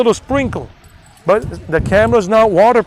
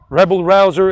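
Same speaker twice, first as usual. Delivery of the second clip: usual speaking voice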